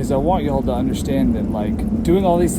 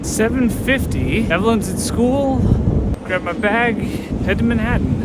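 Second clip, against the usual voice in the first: upbeat; 8 semitones higher, 5 dB louder than usual. Real, not acted. Upbeat narration on the move.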